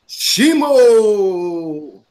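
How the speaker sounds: long, high drawn-out ring introduction; announcer's name call. Acted. Host announces the co-host's name.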